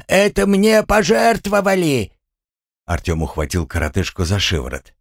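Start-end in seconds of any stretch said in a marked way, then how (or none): 0.1-2.1 high-pitched outraged protest; indignant yell. Acted. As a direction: protest at the top of the voice. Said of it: "заорал Гремлун". (возмущённо вопя высоким голосом)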